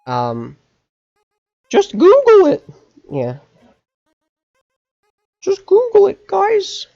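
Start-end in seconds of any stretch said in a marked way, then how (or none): 1.7-2.7 high, loud squeaky shout. Pretend. Mocking shouted advice to chat. 5.4-6.9 high, loud squeaky shout. Pretend. Repeats the mocking shouted advice.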